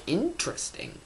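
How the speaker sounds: high, soft, knowing "interesting"; intrigued aside. Retold or imitated. English nerd noticing the colour symbolism, high soft intrigue. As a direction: pitch it up softly, as if intrigued. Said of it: "So I'm like".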